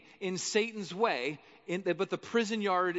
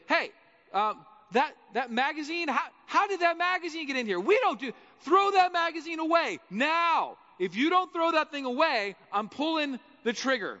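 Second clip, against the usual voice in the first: high-pitched, indignant scolding that gets louder; bossy guard's threat; 7 semitones higher, 4 dB louder than usual. Acted. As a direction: scold a prisoner caught with contraband. Tower guard Jesus threatens a prisoner.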